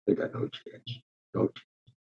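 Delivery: very soft, half-whispered aside; hushed inner thought. Retold or imitated. Reporter thinking to himself over the book, hushed. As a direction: think it under your breath. Voicing his private thought on reading.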